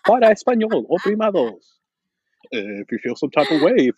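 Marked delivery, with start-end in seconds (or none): 0.1-1.8 lightly voiced automated menu, higher pitch; mock hotline recording; phone menu voice. Host mimics a hotline menu, recorded-message voice.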